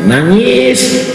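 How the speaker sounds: put-on high wailing cry of a baby; mock baby wail. Preacher imitating a baby's cry, high mock wail. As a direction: wail it like a hungry infant. Mimics baby crying in story.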